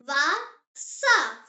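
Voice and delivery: "Wasser" split into two separate syllables; slow model pronunciation. Shown as a demonstration. Teacher has learners repeat the German word, slow model pronunciation. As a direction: say "Wasser" slowly, with a gap mid-word. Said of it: "Say it".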